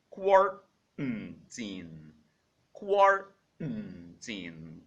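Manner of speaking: quarantine stretched out very slowly; slow model word. Shown as a demonstration. Teacher drilling quarantine very slowly for learners. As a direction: drag out quarantine syllable by syllable. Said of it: "It is called".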